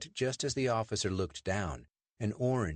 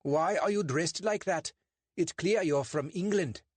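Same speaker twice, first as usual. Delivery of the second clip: stern English constable, pitched much higher; a suspicious bobby lecturing two suspects; portly constable, stern and clipped; 10 semitones higher, 4 dB louder than usual. Acted. Constable questions.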